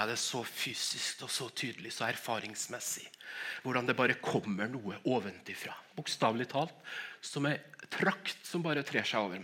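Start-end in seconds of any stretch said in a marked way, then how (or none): none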